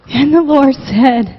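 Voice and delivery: ordinary delivery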